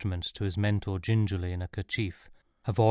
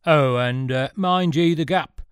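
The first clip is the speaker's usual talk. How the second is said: loud, raised coach driver's call; 6 semitones higher, 8 dB louder, slower than usual. A narrator's reading. Coach driver to alighting passengers, calling out loudly. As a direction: shout a warning to passengers. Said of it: "called the driver".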